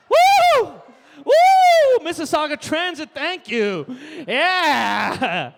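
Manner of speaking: shrill high whoops shouted; comic cheering for Mississauga Transit; whooping cheer. Real, not acted. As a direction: whoop up the home crowd. Cheering for his home town.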